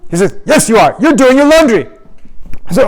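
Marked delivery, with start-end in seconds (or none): 0.5-1.9 high, loud accusation; strict teacher busting them; scolding teacher. Retold or imitated. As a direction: call them out.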